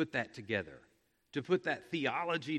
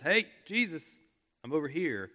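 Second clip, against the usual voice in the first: loud call for attention, higher; hollering Jesus across the crowd; 5 semitones higher, 8 dB louder, faster than usual. Retold or imitated. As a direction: yell it out to be noticed. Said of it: "he is yelling, hollering with all he is".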